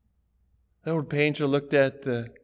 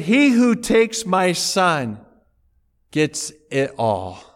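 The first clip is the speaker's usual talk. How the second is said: slow, weighty pronouncement; solemn reading; 3 semitones higher, 6 dB louder, slower than usual. Retold or imitated. Auctioneer reading the painter's will, slow and solemn. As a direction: read the will slowly and gravely.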